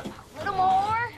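loud, drawn-out effort; straining. Acted. Kat heaving a fridge with Jen, loud strained call. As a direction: push the words out under a heavy load.

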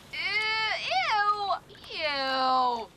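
loud, drawn-out cry of revulsion; disgusted ew. Acted. Kat seeing wet Kleenex, loud disgusted ew. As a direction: wail ew in revulsion.

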